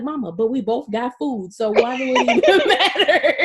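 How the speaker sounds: pleading through a giggle; amused, exasperated daughter; laughing plea. Retold or imitated. Telling her mother it doesn't matter.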